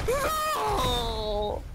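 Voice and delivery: sharp high cry of "No!" at the loss; real dismayed shout. Real, not acted. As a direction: yell it as you get hit. Dies in game, shouts.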